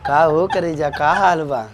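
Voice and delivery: low, soft, cosy murmur; private phone chat. Acted. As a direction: keep it low, chatting tenderly on the phone. Husband chats privately on phone. (Phone पर धीरे-धीरे मीठ बतकही)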